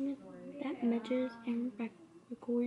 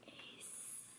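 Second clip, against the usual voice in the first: whispering; 7 semitones lower, 8 dB quieter than usual. Real, not acted. Tutor and child whisper aside.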